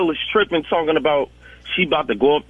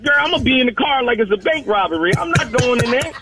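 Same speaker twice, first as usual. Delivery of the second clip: animated; 9 semitones higher than usual. Retold or imitated. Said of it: "I'm like".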